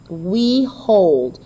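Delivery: WE light, HOLD stressed and drawn out; measured iambic demo with weight on HOLD. Shown as a demonstration. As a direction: lean on HOLD after a light WE. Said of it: "So this is written in iambic pentameter".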